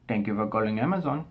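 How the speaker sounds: imitated call-centre greeting in an Indian accent; lightly put-on Indian accent. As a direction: mimic an Indian-accented phone agent. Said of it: "has a thick Indian accent".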